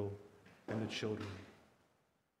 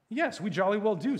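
higher-pitched, emphatic comeback; indignant retort; 8 semitones higher, 6 dB louder than usual. A narrator's reading. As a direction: answer back stoutly. Scrub retorts to the witch.

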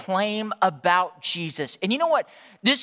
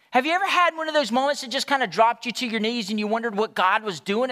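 ordinary talk

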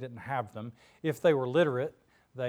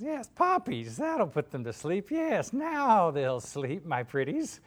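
high, slow gloating witch; Wicked Witch voice; 5 semitones higher, 4 dB louder, slower than usual. Acted. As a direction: savor the plan, slow and sly. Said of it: "She says".

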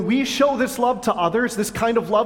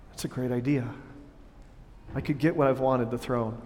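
much softer, lower, tempted murmur; imagined musing; 7 semitones lower, 8 dB quieter than usual. Retold or imitated. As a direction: say it as a secret temptation. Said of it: "instead of Jonathan going".

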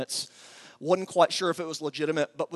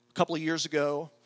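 ordinary talk

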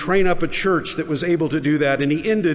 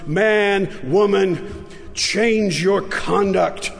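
preaching rebuke; 4 semitones higher than usual. Retold or imitated. Preacher rebukes cowardly believers, building to full force. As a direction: thunder the charge at the congregation.

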